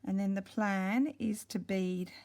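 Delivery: ordinary talk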